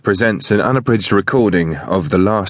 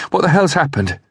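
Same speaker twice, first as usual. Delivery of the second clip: high, shocked woman's voice; shocked question; 11 semitones higher than usual. A narrator's reading. Woman facing the scene of slaughter, shocked question. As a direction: ask it high, taken aback.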